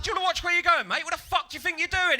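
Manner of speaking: loud, high indignant outburst; angry confrontation. Acted. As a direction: tear into him, high and loud. Confronting man who stamped on head.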